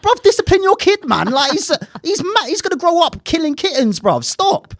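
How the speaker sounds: high, loud mock telling-off; exasperated rant. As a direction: blast the lazy parent. Retold rant at lax parent.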